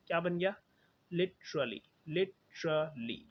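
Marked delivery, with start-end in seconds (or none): 1.1-1.8 Literally as li-chruh-lee; three syllables. Shown as a demonstration. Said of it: "तीन बन गए". 2.1-3.2 literally slowly as lit, chruh, lee; three syllables. Shown as a demonstration. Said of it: "तीन बन गए".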